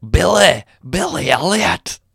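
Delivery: slow, high-pitched attempt at Scottish; Scottish accent. Shown as a demonstration. Second try at the accent for co-host, exaggerated Scottish. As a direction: say the Geordie name in mock Scottish. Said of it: "said a Geordie name in a Scottish accent".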